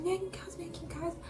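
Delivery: high, breathless whisper of a plea. Retold or imitated. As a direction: barely whisper it, begging. Begging aunt, voice collapsing. (súplica sussurrada e aguda)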